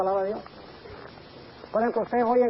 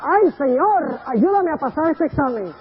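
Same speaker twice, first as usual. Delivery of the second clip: lazy student's plea; 5 semitones higher, 5 dB louder, slower than usual. Retold or imitated. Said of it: "y le dice al Señor".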